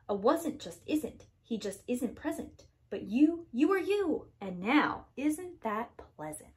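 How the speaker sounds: ordinary delivery, nothing marked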